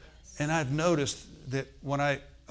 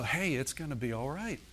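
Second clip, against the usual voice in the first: soft, high, tender reassurance; gentle coaxing, rising in pitch; reassuring God; 5 semitones higher, 3 dB quieter than usual. Acted. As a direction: soothe like a parent to a sweetheart, climbing high. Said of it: "He wants to let you know".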